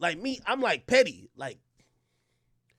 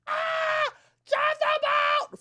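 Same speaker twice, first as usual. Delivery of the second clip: mock gaping; much slower than usual. Mocking her wide mouth.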